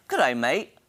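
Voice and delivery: trying out an Australian accent; amateur Aussie greeting, tune lifting at the end; practiced Australian accent. Shown as a demonstration. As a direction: have a go at sounding Australian. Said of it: "let me practice that. Ready?".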